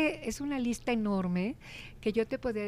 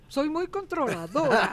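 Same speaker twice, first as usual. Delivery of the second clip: bossy teacher; 5 semitones higher, 6 dB louder than usual. Shown as a demonstration. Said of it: "mira, ¿ves?".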